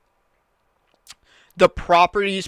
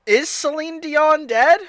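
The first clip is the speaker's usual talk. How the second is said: shocked; 10 semitones higher, slower than usual. Real, not acted. Shocked at the news.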